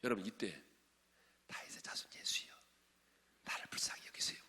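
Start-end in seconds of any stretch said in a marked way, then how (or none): 1.5-4.4 whispered, soft little plea; mock timid whisper. Shown as a demonstration. Preacher showing how the blind man did not call, timid whisper. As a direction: whisper it as a feeble, hushed call. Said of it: "이렇게 한 줄 아세요?".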